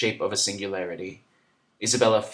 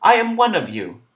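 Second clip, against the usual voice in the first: louder and much higher, pleading; wished shout; 10 semitones higher, 9 dB louder than usual. A narrator's reading. Narrator voicing her urge, a pleading high call. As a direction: cry out the truth to the table. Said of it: "to shout in Irkutsk".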